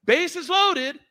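high, chirpy coach, slow on the last call; drawn-out high coach announcing a fly ball; mock coach voice. Coach calling a fly ball to Isla, high and slow. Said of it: "Coach is like".

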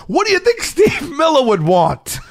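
high, slow incredulous question; retold indignant appeal. Dancer to seated heckler, retold indignant appeal. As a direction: appeal to them, exasperated.

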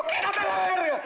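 grito agudo de conductor desquiciado, actuado; grito imitado. Retold or imitated. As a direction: suelta un grito loco en broma. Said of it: "me ataco de risa y le grito". (imitando un arrebato al volante, muy agudo)

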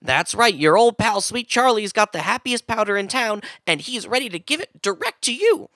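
hammed-up salesman's pitch; ad read. Nick pitches happy powder to podcast listeners, ad read. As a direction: sell it like a chummy sponsor spot. Performs spoof sponsor ad.

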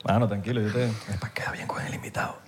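hushed calming whisper. Real, not acted. Host whispering to calm his co-host in front of the guest. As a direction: whisper to settle him down quietly. Whispered aside between hosts.

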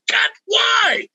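very high, pained cry of why; anguished shout. Retold or imitated. Tom crying out as friends kick his car, anguished shout. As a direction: wail at them for kicking the car. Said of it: "I went".

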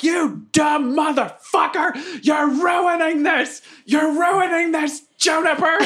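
high, drawn-out heckler's shout; outraged screaming. Acted. Outraged spectator screaming at a fighter in the ring, mock fury. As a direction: stretch the words in hammed-up fury. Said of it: "I'm just screaming stuff at the Forsaken".

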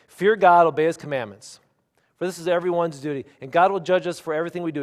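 loud, forceful proclamation of a verse; emphatic scripture reading. Acted. Preacher reading Ecclesiastes' last verses to the church, emphatic scripture reading.

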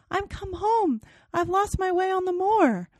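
put-on the lost child asking for home; ghost child. Ghost girl outside the window, high plaintive child voice. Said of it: "As it spoke".

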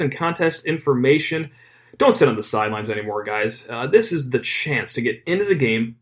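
punchy podcast ad read with stretched key words; sponsor ad read. Acted. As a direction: sell it with lively, swinging pitch. Host reads sponsor ad.

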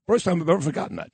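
ordinary delivery

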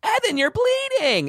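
high, slow whiny cry; annoying voice. Retold or imitated. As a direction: squeal it out in a high whine. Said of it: "he would do this annoying voice".

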